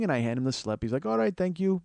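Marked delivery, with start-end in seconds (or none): 1.1-1.8 put-on higher-pitched, botched Ethiopian cabbie accent; bad Ethiopian accent. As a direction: thank them in a poor Ethiopian accent. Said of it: "I can't do an Ethiopian accent".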